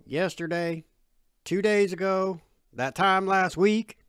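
edge in voice. Acted. Dad tallies Bud's breakfast visits, loud and edgy. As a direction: count off each offence with an edge. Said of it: "an edge in his voice".

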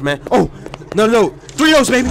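usual speaking voice

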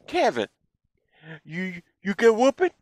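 high, giggly little-brother voice; little brother teasing Kevin about his punishment. Retold or imitated. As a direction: needle him like a gleeful kid brother. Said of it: "Dorian would be like, laughing".

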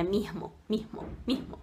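"mirmo" quietly repeated with a breathed H; soft "mirmo", S exhaled before the M; aspirated S as R. Shown as a demonstration. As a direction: demonstrate "mirmo" for mismo, low and soft. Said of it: "soa bastante esse Rzinho".

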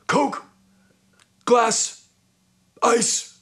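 high one-word commands with pauses between; imitated curt order. [name] ordering from the drinks cart, imitated curt commands. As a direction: order each item separately, pitched well up. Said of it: "he says to the guy".

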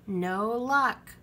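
ordinary delivery, nothing marked

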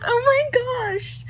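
high, drawn-out amazed gasp of words; stunned, voice shooting up in disbelief; freaking out. Real, not acted. Vlogger sees the subscriber count reach eighty thousand, freaking out. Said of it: "I'm freaking out".

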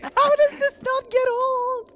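high, slow laughing squeal. Real, not acted. Laughing at the prank.

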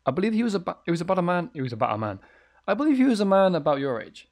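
a landlady struggling to remember. Acted. Voices the landlady recalling.

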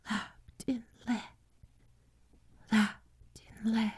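high, soft whisper, slowing; faint ecstatic whisper; almost inaudible. A narrator's reading. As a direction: breathe it out in glimpsed peace. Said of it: "Almost inaudibly, ... she breathed out the words".

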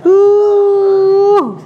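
high, sudden yelp; a quick high pained cry; short high yelp of pain; sharp cry of pain. Real, not acted. Patient pain during massage.

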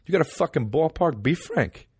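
put-on high, pushy New York accent. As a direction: push the question in a thick New York accent. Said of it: "in New York, they don't even say".

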